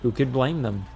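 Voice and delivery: ordinary delivery, nothing marked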